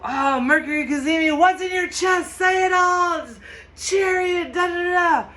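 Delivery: big, high shouting voice for the cards; loud card voice. Acted. Tarot reader voicing a loud card to her viewers, high excited shout. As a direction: belt it out like the card is yelling at them.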